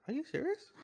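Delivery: high squeak of disbelief; incredulous kid. Retold or imitated. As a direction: Kevin to his mum, squeaky and incredulous.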